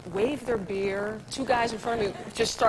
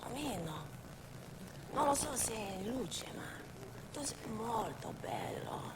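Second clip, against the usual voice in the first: Marge Simpson in Italian, softer and slower; 11 dB quieter than usual. Shown as a demonstration. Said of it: "in Italiano si dice".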